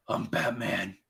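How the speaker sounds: low, hushed Batman imitation; soft mock hero growl; lightly voiced classic Batman. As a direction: drop into a hint of the iconic Batman voice. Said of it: "whenever we hear, we all think that".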